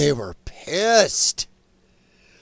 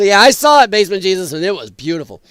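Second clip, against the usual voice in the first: ordinary talk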